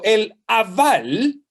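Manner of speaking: aval drawn out slowly, stress on the last syllable; slow Hebrew word. Shown as a demonstration. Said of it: "en la palabra perverso".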